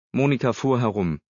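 ordinary delivery

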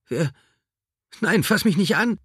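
high, loud frightened yell; panicked shout. Acted. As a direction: cry out in panic. Confused woman fights captor.

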